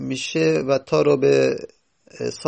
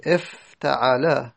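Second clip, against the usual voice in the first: افتعل said slowly, each syllable clear; slow teaching demo; much slower than usual. Shown as a demonstration. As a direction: drill افتعل slowly for students. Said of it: "teacher spells out form".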